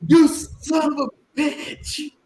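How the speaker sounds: very high, drawn-out yell; shout. Retold or imitated. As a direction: yell out in pain. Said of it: "and I was like".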